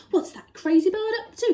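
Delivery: loud, high exclaim; cried out. Acted. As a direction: shout in baffled alarm. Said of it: "cried the Duke".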